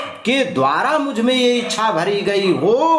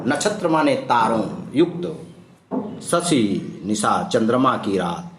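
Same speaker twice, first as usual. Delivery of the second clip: normal talking voice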